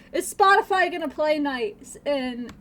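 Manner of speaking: ordinary delivery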